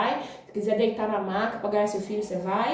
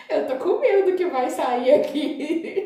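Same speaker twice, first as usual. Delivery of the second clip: laughing through the words, louder and higher; 6 semitones higher, 4 dB louder than usual. Retold or imitated. Telling her friends she's scared, laughing. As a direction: giggle it out while admitting fear. Fear of pooping during birth.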